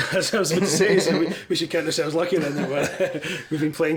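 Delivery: laughing, quick and bright. Real, not acted. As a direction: laugh while owning up to it. Laughing at the joke about lineups.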